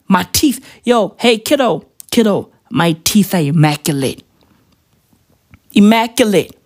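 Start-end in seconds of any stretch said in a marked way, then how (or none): none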